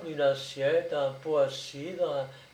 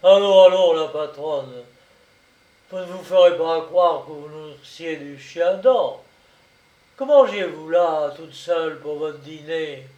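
loud, hearty interrogator; coaxing brigadier; 9 dB louder than usual. A narrator's reading. Brigadier questioning the peasant woman about dinner, jovially insistent. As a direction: press her with jovial disbelief.

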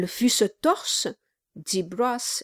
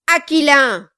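loud, indignant correction; shouting; 4 semitones higher, 9 dB louder than usual. A narrator's reading. Dwarf bursts in to correct his mother-in-law, shouting. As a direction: shout it out, high and forceful. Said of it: "cria Kilp".

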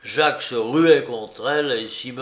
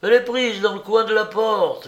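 high, louder urgent cry; excited discovery; 10 semitones higher, 6 dB louder than usual. A narrator's reading. Watchmaker finding the cord caught in the door, excited cry. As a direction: blurt out the clue.

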